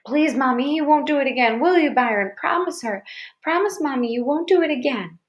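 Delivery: put-on high, begging little girl; anxious child's desperate appeal; tearful pleading. A narrator's reading. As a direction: beg Mama through threatened tears. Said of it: "The tears really started coming".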